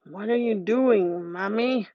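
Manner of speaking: a changeling baby speaking as a lightly voiced old man; hint of a creaky old man asking his mother. A narrator's reading. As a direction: sound like an ancient man inside a baby. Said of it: "says in the voice of a very old man".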